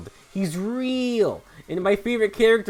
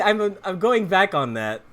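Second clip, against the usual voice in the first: usual speaking voice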